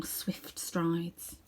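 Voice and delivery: usual speaking voice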